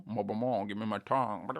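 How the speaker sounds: hammed-up mock French; bad French accent. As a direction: clownish attempt at a French accent. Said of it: "That's not a French accent".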